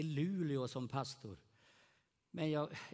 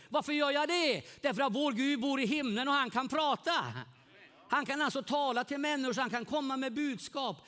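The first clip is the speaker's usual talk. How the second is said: preaching at full force; 7 semitones higher, 5 dB louder than usual. Acted. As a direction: preach it out to the whole hall, lifting each word.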